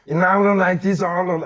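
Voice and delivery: intense Arnold. Shown as a demonstration. Showing friend his loud Arnold impression, intense Arnold voice. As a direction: bark it like a fired-up bodybuilder. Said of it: "I like calm Arnold".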